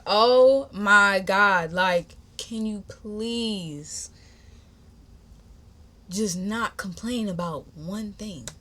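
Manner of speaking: drawn-out, exasperated plea; fed-up "oh my God", stretched slow. Retold or imitated. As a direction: drag it out like you've had enough. Acting out her exasperated reply to him.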